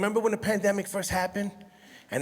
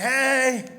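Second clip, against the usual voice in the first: high, drawn-out flirty hey; hammed-up camp hello; put-on camp greeting; 6 semitones higher, 4 dB louder than usual. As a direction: stretch it out high, pretending to flirt.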